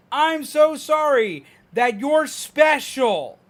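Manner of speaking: high, loud, slow mock sympathy; overdone condescending sorry, imitating strangers; loud obnoxious pity. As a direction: drag out the fake pity, loud and syrupy. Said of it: "they talk like that. It's loud and obnoxiously done".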